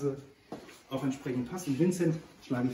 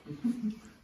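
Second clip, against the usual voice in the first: real laugh; 9 semitones higher than usual. Real, not acted. Laughs at own full mouth.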